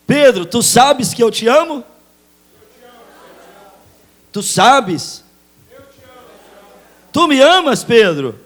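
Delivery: high, loud insistent questions; Jesus pressing Peter. Acted. Jesus pressing Peter again, loud and high. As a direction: hammer the question home. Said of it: "Jesus questioning Peter".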